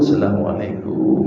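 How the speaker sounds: hushed, barely voiced salam at the door; a breathy greeting from outside the door; soft knock greeting. Doctor calling softly at a stranger's door. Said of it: "Misi, gitu ya".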